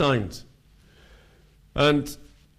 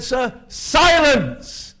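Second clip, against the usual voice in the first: slow, high, drawn-out "Sir, silence"; stern passer-by; 10 semitones higher, 5 dB louder, much slower than usual. Retold or imitated. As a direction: hush the preacher with slow, weighty scorn. Retold passer-by silencing preacher.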